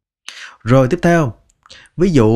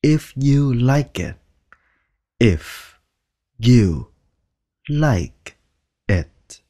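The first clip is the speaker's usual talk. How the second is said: "if you like it" stretched out slowly; slow careful; much slower than usual. Shown as a demonstration. Teacher modelling the phrase slowly first. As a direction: draw it out slowly. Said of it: "Đọc nhanh là".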